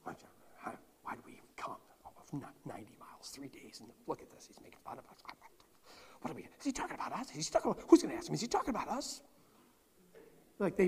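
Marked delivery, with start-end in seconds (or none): 0.0-9.2 whispered grumbling among the delegation; mock mumbling. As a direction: grumble it under your breath, indignant. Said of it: "this delegation mumbling to themselves".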